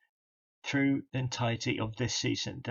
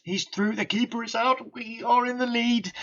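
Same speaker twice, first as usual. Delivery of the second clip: excited shout; 10 semitones higher, 5 dB louder than usual. Real, not acted. His striker scores a goal.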